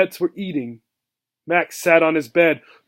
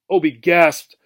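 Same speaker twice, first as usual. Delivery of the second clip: usual speaking voice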